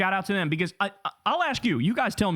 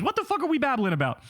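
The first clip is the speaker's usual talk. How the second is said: pitched way up in exasperation; can't believe what he's reading; exasperated disbelief; 6 semitones higher than usual. Real, not acted. Host mocking the article, exasperated and pitched up.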